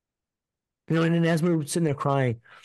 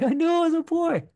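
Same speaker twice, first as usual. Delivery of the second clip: much higher, put-on squeak through a laugh; mock squeaky voice. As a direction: squeak it out like a giddy kid. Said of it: "I was like".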